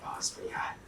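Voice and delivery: nervous whisper. Retold or imitated. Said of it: "I'm like".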